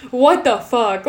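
comically appalled impression of a friend; incredulous retold reaction. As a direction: imitate her baffled disgust, punched loud. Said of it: "the way she says it".

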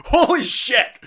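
high, loud mock shock; hearing the streamer's rant, a loud high gasp of shock; stunned exclamation. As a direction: act like you can't believe your ears. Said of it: "I was sitting there going".

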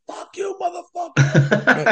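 high, drawn-out mock holler; imitated drunken-rivals yelling. Imitating big-drinking rival fathers as banter turns nasty, high mock yell. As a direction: act out banter turned into a shouting match. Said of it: "then it turns into".

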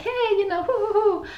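very high, hooting imitation of merrymakers; mock cheerful crowd. Melancholic child imitating the cheerful people around her, mock merriment. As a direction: hoot like the happy people around you. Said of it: "or so cheerful".

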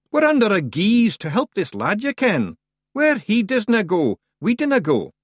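high, emphatic Scottish refusal; defiant Scottish Feegle. Acted. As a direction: refuse stoutly in a high Scots voice.

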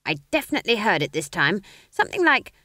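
a hint of a stern witch, rising with urgency; stern old witch. A narrator's reading. Granny insists.

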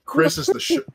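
high excited disbelief; shocked. Real, not acted. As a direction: yelp with astonishment. Shock at trillion.

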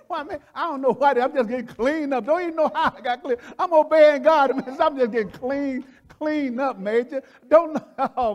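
loud, high preaching joy; joyful shouting. Real, not acted. Preacher exults, shouting about cleansing.

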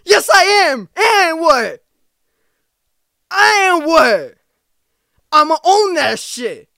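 imagined comeback to the haters, shouted; defiant shout. Pretend. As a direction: yell it right back at the critics, owning it. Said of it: "I'm probably gonna be like".

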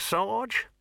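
high nasal little corporal; squeaky weaselly watchman voice; Nobby voice. A narrator's reading. Nobby character voice.

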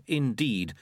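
plain reading voice

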